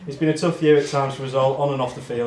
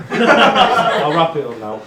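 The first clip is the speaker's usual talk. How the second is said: laughing; 4 semitones higher, 9 dB louder, much slower than usual. Real, not acted. Laughs while wrapping up speech.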